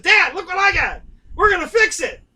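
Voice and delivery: put-on high, loud, eager little boy showing off his prize; bursting with excitement, like an eight-year-old; excited kid. Boy shows dad his bike.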